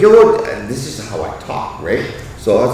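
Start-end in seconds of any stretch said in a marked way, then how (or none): 0.0-0.4 loud high call across the street; yelling out. Retold or imitated. Calling out to his wife searching outside, loud high yell. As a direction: shout it out to her, pitched way up. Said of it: "I was just yelling out to her".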